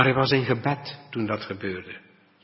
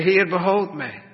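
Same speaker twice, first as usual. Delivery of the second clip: higher and louder desperate plea; cry for help; 7 semitones higher, 5 dB louder than usual. Acted. Peter cries out to the Lord while sinking, loud plea. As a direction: call out like a sinking man. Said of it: "riep, riep hij".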